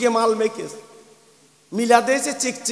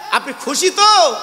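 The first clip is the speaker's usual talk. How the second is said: higher call with a swooping last word; raised, insistent question; 7 semitones higher, 6 dB louder than usual. Acted. Preacher pressing whether they are happy, called out. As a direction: call it out to get an answer.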